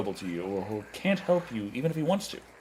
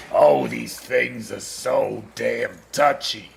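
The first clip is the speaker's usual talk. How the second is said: slow, louder frustrated complaint; exasperated groan; 3 semitones lower, 7 dB louder, much slower than usual. Real, not acted. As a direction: drag it out, fed up.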